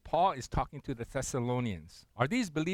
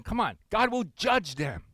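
ordinary talk, nothing marked

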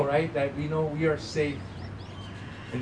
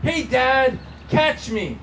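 put-on a child's call, pitched up and louder; mock yell; 7 semitones higher, 4 dB louder, slower than usual. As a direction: call out to dad as if from above. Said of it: "heard a voice from above me yell".

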